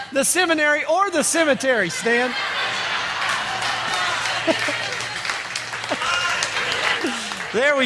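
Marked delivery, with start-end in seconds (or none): 0.1-2.3 higher, chuckling through the correction; real amused correction; laughing at a slip. Real, not acted. Laughs at his own slip. 2.4-7.5 high, breathy drawn-out laugh at his own mistake; real amused laughter; long laugh. Real, not acted.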